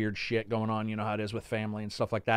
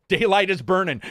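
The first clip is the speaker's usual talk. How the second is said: higher, louder barked dad line; imitating dad; 7 semitones higher, 7 dB louder than usual. Son imitating his father's early morning call, loud mock bark. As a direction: play the impatient father calling out. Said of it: "that was my dad".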